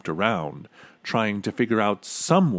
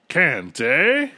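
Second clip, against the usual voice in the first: a sharp, loud, high outraged eh; the king snaps back in fury; raging king; 7 semitones higher, 5 dB louder than usual. A narrator's reading. King flares up at the boy in a rage. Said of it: "flew into a terrible rage".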